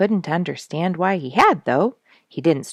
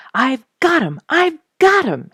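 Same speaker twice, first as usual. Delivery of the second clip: high and loud, bursting with news; excited announcement; 12 semitones higher, 8 dB louder than usual. A narrator's reading. Duke rushing to the cabin with news, excited shout. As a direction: shout the good news as you run in. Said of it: "he announced excitedly".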